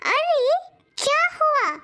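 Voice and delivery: friendly bird character asking what happened; curious bird. Acted. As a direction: open high with surprise, then ask with concern. Bird questions the animals.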